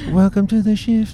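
high, bright show-opening announcer voice; radio host intro. Shown as a demonstration. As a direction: open the show like music just ended. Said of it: "just as if the music is just finished".

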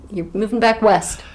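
usual speaking voice

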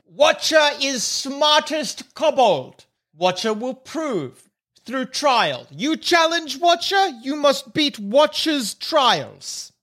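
proud kobold voice, slow and high-pitched; Watcher the kobold. Acted. Watcher sets trials for the challengers, pompous kobold voice. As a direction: declaim slowly in pidgin, self-important. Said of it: "draw the bathrobe up and say".

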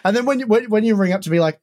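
ordinary talk

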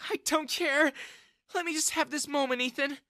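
high, tearful acted plea; sobbing. A narrator's reading. Woman hugging wronged young man, sobbing. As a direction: plead through mock sobs. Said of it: "Jessica sobbed".

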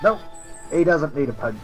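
ordinary talk, nothing marked

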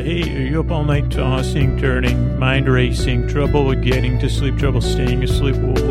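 ordinary delivery

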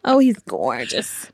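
slow, stretched-out mock swoon; teenage self swooning. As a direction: draw out the admiration like a teen. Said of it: "Did you talk like that?".

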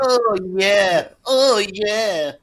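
loud, high triumphant rally cry; Kinnock's "oh yeah". Retold or imitated. Kinnock recreating his rally cry for the crowd, loud and high. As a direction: belt it to the crowd like a cheesy politician. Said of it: "Oh, he recreated it".

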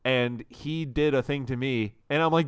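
ordinary talk

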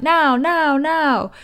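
high, insistent now, now, now; impatient ego. Pretend. Ego pushing impatiently, high and insistent. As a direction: demand it like a spoiled child.

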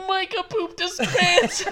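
squealed out high through laughter, slowly; laughing hard. Real, not acted. Real helpless laughter. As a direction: tells his aunt what happened, laughing high and helpless. Retold telling aunt while laughing.

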